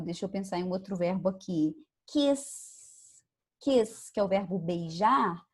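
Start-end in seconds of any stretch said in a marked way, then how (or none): 2.1-4.1 kiss ending on an S that is only breath; voiceless S. Shown as a demonstration. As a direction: hiss the S in kiss with no voice. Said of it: "o S só sai o vento".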